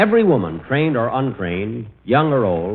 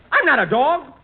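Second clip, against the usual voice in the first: high protest, stress on I'm; high rising protest; indignant protest; 12 semitones higher than usual. Acted. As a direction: insist you're no dog. Dream, protesting being a dog.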